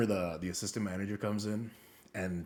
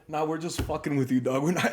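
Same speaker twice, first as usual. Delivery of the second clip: higher, louder, rougher retelling; louder with cursing; 8 semitones higher, 9 dB louder than usual. Retold or imitated. Robber telling the cashier, louder and cursing. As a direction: toss out the curse loudly. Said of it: "like, with cursing".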